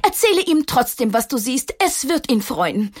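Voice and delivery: lightly voiced gentle aunt; a hint of a wise older lady; kind aunt. A narrator's reading. Tante Elli advises worried Maxchen, gently.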